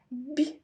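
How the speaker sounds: "б" alone, short and palatalised; soft consonant sound. Shown as a demonstration. Teacher gives children the first sound, soft "б". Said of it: "М'який приголосний".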